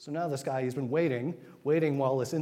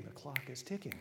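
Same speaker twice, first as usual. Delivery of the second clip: hushed repeat; 9 dB quieter than usual. Shown as a demonstration. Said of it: "And the clock is ticking".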